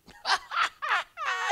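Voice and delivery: high, loud mock guffaw; father's big performed laugh; acted belly laugh. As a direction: burst out in staged laughter. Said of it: "His father began laughing so hard".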